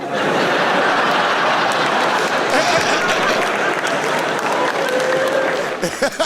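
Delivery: high breathy unvoiced cackle; his trademark cackle. Real, not acted. Comedian breaking into his signature laugh, high breathy cackle. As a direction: let the famous cackle ride. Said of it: "I now work as a laughter therapist".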